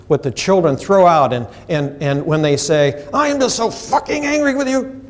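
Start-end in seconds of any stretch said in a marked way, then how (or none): 3.1-5.0 much higher, heated angry cry; angry child's outburst. Acted. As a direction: throw the anger at the carer. Child voiced, angry at carer.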